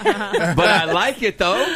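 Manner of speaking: speaking through laughter; laughing. Real, not acted. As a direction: chuckle through the words. Host laughing at random guess.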